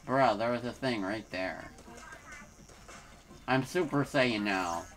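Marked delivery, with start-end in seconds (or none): none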